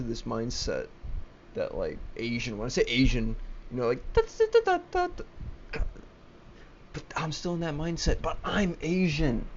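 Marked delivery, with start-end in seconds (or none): 4.1-5.2 very high sing-song nonsense syllables, a bit louder; mock foreign babble. Ranting vlogger mocks East Asians with very high nonsense syllables. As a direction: squeak out mock gibberish.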